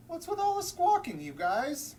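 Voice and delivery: high, loud grumpy squawk; penguin complaint. Acted. Grumpy penguin yelling at squawking birds.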